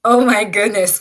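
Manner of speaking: ordinary talk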